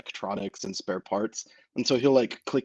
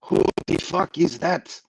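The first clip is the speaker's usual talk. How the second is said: louder, higher fake French outburst; bad French accent; 7 semitones higher, 6 dB louder than usual. Felix has never heard of Raphael, incredulous bad French accent. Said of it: "in a very bad French accent".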